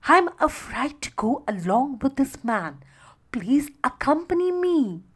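ordinary delivery, nothing marked

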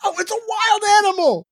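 high, loud, fast panicked scream. A narrator's reading. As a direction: shriek it in terror. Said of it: "and screams".

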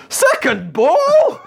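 high, long-stretched football yell; shouting. Acted. Football fan shouts as the second star appears, high drawn-out yell. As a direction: holler it across a pitch, stretching the words. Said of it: "So he shouts".

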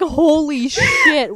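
high, loud and very slow amazed outburst; starstruck. Retold or imitated. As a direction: act dazzled by a celebrity. Starstruck at meeting a YouTuber.

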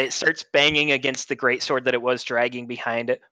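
ordinary delivery

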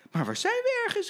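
put-on very high, childlike puzzled cry; children calling. Schoolchildren calling to the driver, high and puzzled. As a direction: squeak it like a lost child. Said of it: "riepen de kindertjes".

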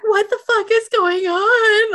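high, loud, drawn-out wail; acted distraught. Girlfriend pretending not to be in on it, distraught. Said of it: "she's acting distraught".

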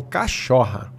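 slow cachorra, Taubaté style; Taubaté accent. Shown as a demonstration. Explaining how most in Taubaté talk, slow Taubaté accent. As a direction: draw out cachorra like a Taubaté local. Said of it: "quase todos de Taubaté".

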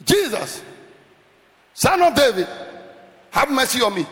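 pressing cry for mercy; third-gear plea. Shown as a demonstration. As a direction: raise the plea, not yet at full shout. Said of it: "now let's go to fourth gear".

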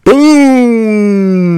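loud, high, very long "boom"; big comic sound effect; huge impact boom. Retold or imitated. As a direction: stretch the explosion out. Imitates club impact.

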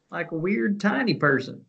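high, silly little voice; tiny person voice. Pretend. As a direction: squeak it up playfully for a tiny person. Sillier, higher echo adding tiny.

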